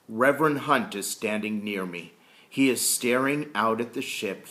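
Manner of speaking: ordinary delivery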